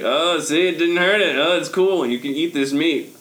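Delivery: flustered, high, loud showman; overbright kids party host. Acted. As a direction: stumble in then sell the magic. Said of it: "He's like".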